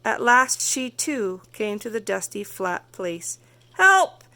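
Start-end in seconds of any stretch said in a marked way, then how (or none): 3.8-4.3 very high, loud "Help!"; cry for help. Acted. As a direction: call out in sudden danger. Monster truck nearly crushes Tortoise.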